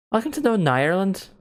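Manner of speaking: high, swooping Northern Irish lilt; Northern Irish accent. Pretend. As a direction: put on a stronger Northern Irish accent, rising at the end. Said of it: "I wish I had a strong accent".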